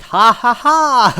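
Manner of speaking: loud, high, slow hammy villain cackle; villain laugh. Retold or imitated. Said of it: "the weird villain laugh".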